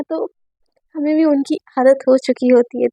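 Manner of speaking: plain reading voice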